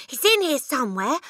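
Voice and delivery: high, loud, urgent insistence; excited cry. Acted. Matilda starting to search the room, excited cry. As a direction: raise the alarm excitedly. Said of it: "Matilda cried".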